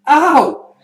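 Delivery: sharp high loud ow; pained yelp. Retold or imitated. Sister hit by the sword, loud high yelp. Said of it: "And she's like".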